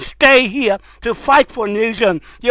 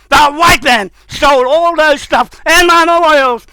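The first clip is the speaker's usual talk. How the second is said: raised, high-pitched outrage; angry accusation; 5 semitones higher, 4 dB louder than usual. Real, not acted. Speaker accusing others of stealing memorials, angry outburst. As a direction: accuse them with real anger. Angry rant about theft.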